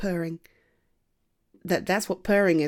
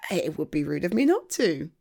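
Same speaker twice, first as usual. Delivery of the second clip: ordinary talk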